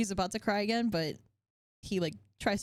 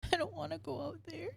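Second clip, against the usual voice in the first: put-on scared crying child; 12 semitones higher, slower than usual. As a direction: whimper it slowly, breaking into a small cry. GM voices frightened crying boy.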